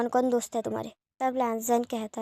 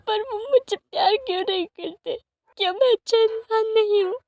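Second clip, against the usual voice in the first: much higher, tearful pleading with a sob breaking through; crying; 8 semitones higher, 3 dB louder than usual. Retold or imitated. Son crying to his friend about his father, tearful. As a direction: ask why he's unloved, choking on tears. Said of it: "तब लैंजन रोते हुए कहता है".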